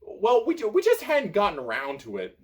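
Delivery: high, loud flustered squeak; squeaky. A narrator's reading. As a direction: squeak out the excuse. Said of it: "squeaks Pothos".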